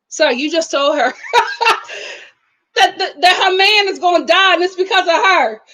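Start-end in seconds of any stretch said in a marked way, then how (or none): 1.2-2.1 a short, airy, high giggle; high breathy laugh. Real, not acted. Vlogger laughing at the brother's clueless question, breathy giggle. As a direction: laugh in disbelief under your breath.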